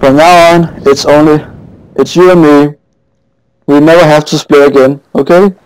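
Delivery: high, tender voice to a car; mock tender love talk. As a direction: croon it like a reunited lover. Reunion with the car.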